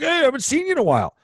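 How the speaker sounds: put-on high, fast, friendly old-timer greeting; cheerful old man. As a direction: pitch it up as the chirpy regular from the counter. Voicing older gentleman at counter.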